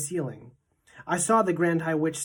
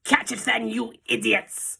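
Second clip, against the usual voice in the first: Grand High Witch screaming; 6 semitones higher than usual. A narrator's reading. Witch leader screaming at her witches from the platform. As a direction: snarl it at the fools below. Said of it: "screamed the Grand High Witch".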